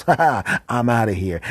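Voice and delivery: high, loud mocking fly; fly laughing at the narrator as it escapes, high and loud; taunting fly. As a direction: jeer it as you flee.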